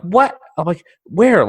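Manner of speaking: high, loud sharp questions; jolted awake, demanding where; startled panic. Retold or imitated. Groggy husband demanding where the spider is, high and loud. Half-asleep panic hunt.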